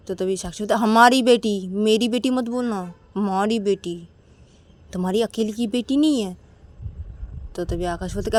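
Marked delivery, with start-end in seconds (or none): 0.7-6.4 sharp correction. Acted. Sakshi corrects "my daughter.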